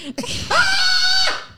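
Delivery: very high, loud shriek; high scream. Acted. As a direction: let out a sudden piercing scream. Acts out sister incident with scream.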